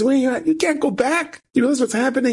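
urgent, high-pitched disbelief; alarmed protest. Retold or imitated. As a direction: voice leaps up as you try to stop a friend. Acted plea to friend not to leave.

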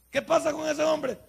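preacher confronting the men, loud and high; preaching loud. Real, not acted. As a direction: throw the question at the men. Preacher challenges the congregation.

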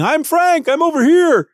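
high, loud call from far below; tiny guy shouting to be noticed; yelling. Retold or imitated. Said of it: "is yelling".

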